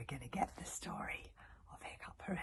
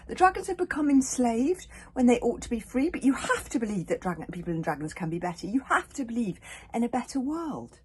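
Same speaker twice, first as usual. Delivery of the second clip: loud, higher, passionate insistence; fierce; 9 semitones higher, 14 dB louder than usual. A narrator's reading. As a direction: fight for what you believe. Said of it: "said Hiccup fiercely".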